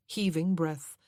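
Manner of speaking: ordinary delivery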